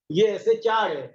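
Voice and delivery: ordinary talk, nothing marked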